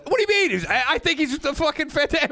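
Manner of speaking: high, incredulous mock outburst; retold indignant protest. Retelling an argument with the talent coordinator, high indignant voice. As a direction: shoot the pitch up in protest. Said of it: "and I go".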